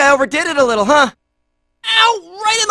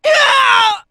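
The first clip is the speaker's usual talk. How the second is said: long, high yell; pained yell; 6 semitones higher than usual. Acted. Fighter is hit and curses, high pained yell. Hurt in battle, before cursing.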